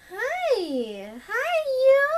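high sing-song pet voice; high doggy greeting, lifting at the end. Real, not acted. Greeting her dog on camera.

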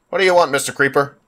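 ordinary talk

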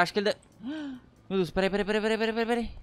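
gasping rushed wait-wait pleas; flustered panic. Real, not acted. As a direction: babble in sudden fright. Gasps, panics in the game. (pânico atrapalhado)

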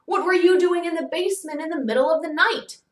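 loud, high-pitched interrogation; indignant crowd questioning; accusing demand. A narrator's reading. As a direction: grill a suspect in disbelief. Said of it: "they'd demand".

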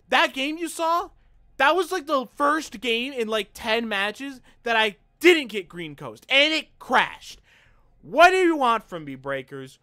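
high and loud outburst; frustrated rant. Real, not acted. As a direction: shout your grievance at the devs. Ranting at the developers.

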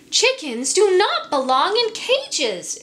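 rallying cry. Acted. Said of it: "she told them".

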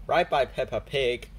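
plain reading voice

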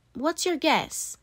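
ordinary delivery, nothing marked

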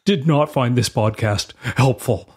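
ordinary delivery

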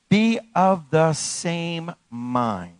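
very slow, spaced-out words; drawn-out scripture emphasis; slow emphatic reading. Real, not acted. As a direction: lay down each word with weight. Slows scripture line for emphasis.

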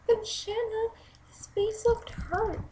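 put-on high, slow little-girl voice; six-year-old girl. Six-year-old Jane to her babysitter about Brian, high childlike protest. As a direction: plead slowly in a small child's high pitch.

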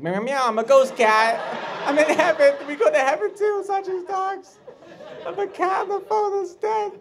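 very high meowing little creature; ghost cat voice. Acted. Dead cat on the phone from heaven, high silly voice. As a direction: squeal it in a falsetto cat voice.

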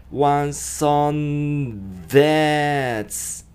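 slow "ワンソン" with a D-like TH; one son. Shown as a demonstration. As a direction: leave out the G and slow down. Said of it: "ザジゼゾ".

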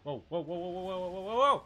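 rapid whoas climbing to a shout; alarmed. Real, not acted. Gamer caught off guard by an enemy, alarmed. Sudden threat in the game.

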